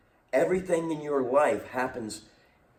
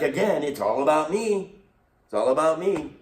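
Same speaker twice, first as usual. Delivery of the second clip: mock selfish whining; 6 semitones higher, 6 dB louder than usual. Voicing upset people.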